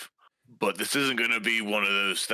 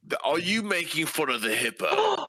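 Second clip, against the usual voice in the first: higher, sharper challenge; indignant outburst; 6 semitones higher than usual. Acted. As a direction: call him out on mocking. Challenging the sheriff.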